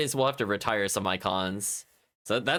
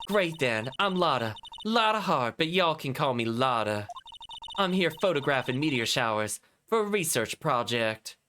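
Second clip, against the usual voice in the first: scolding country twang. Acted. Lotta voiced.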